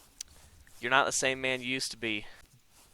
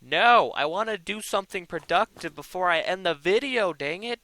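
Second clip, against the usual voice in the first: higher, louder exasperated complaint; frustrated; 6 semitones higher, 7 dB louder than usual. Real, not acted. Gamer complaining he wants progress, exasperated. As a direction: whine in frustration.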